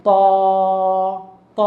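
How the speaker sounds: ত with a heavy, thickened, long vowel; thick sound. Shown as a demonstration. Teacher naming the thick letter, ত said heavy and long. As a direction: say ত thick and drawn out. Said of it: "এটা হলো একটা মোটা ধ্বনি".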